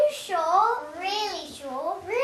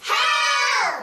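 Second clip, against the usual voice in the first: cry for help; 6 semitones higher, 4 dB louder than usual. Acted. Crocodile attacks, crying for help.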